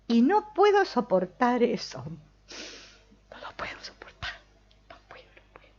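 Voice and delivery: mock despair fading into a whisper; hammed-up lament. The hurt mind mourning a missing hello, mock whisper. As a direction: play the wounded ego, then let it sink to a breathy whisper. Mind's melodrama over unreturned greeting.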